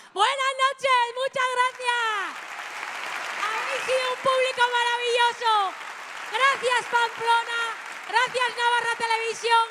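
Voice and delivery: high, loud thank-you to the crowd; stage farewell. Real, not acted. Comic thanking the Pamplona crowd, loud farewell. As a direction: send off the audience with a loud cheer.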